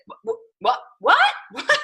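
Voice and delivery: stammered, rising shocked what; retold freaked-out stammer; taken aback. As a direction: sputter in disbelief, ending high. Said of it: "all taken aback, like, freaked out".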